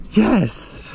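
cracked triumphant yes; voice cracking. Real, not acted. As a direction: let the yes break from pure excitement. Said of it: "My voice cracked when I said yes then".

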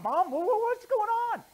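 put-on high, loud stammering kid; startled boy. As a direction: stumble over it like a kid caught off guard.

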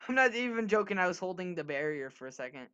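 ordinary talk

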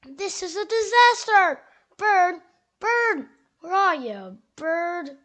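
loud, high panicked shouting; frantic shout that doom has come; alarmist doom warning. Acted. Squirrel panics, shouts for Bird.

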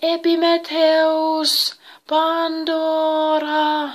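high, loud, long-drawn distant call; eerie hallooing. A narrator's reading. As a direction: call the names from afar, stretching each one. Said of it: "kuulivat ulkoa huhuilua". (kaukainen, venytetty huhuilu)

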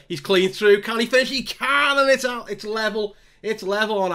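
rising high and loud as the goal goes in; excited goal call. Real, not acted. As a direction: shout it like a fan watching his striker score. Equaliser scored, live commentary.

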